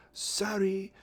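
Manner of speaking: put-on high piping Capitol accent; put-on high-pitched Capitol accent; silly Capitol accent. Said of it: "pipes in her silly capital accent".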